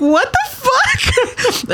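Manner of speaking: high, slow, laughing "what the fuck"; stunned laughing outburst; laughing disbelief. Retold or imitated. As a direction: drag it out through a laugh of disbelief. Shocked reply to dad, laughing.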